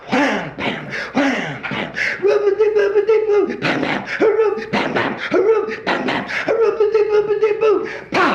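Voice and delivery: mouth-made parade drums with falsetto hurrahs; drum corps imitation. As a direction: mimic a marching band going by.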